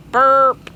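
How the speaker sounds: cartoon belch; burp. A narrator's reading. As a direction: belch it out like a greedy bee. Bee burps.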